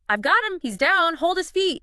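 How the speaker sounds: high, loud urgent cry; shout for help. Retold or imitated. Kemp shouting for help as he grabs Griffin, acted shout. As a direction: call out loudly, pitched right up, for help. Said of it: "And shouts for help".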